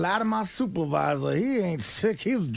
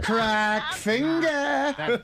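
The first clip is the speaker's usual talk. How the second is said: drawn-out name; 4 semitones higher, much slower than usual. Acted.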